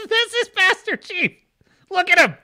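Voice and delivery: high laughing repeat of the name; amused high-pitched laughing repeat. Real, not acted.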